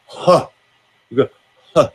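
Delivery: breathy open huh like fogging a mirror; open-throated breath huh. Shown as a demonstration. Voice coach demoing an open-throat breath sound. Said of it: "like you're breathing on a mirror".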